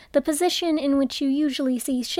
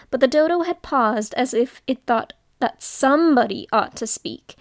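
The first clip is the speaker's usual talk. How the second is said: ordinary delivery, nothing marked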